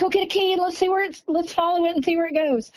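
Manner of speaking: excited. Retold or imitated. Excited sister urging to follow.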